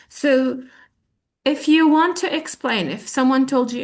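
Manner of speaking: ordinary delivery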